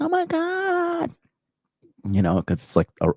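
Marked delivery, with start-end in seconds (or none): 0.0-1.1 much higher and slow, a drawn-out squeal; mock girl squeal. Japanese girls spotting a YouTuber, mock squeal. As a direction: squeal it like a starstruck fan. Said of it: "They're like".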